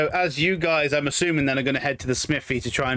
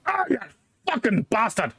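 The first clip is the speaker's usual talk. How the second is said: pained curse; 8 semitones higher than usual. Acted. Dwarf stubs foot inside.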